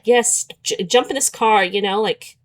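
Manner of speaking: ordinary talk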